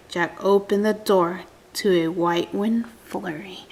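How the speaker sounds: hushed suspense whisper; unvoiced storybook whisper. A narrator's reading. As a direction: breathe it like a secret reveal.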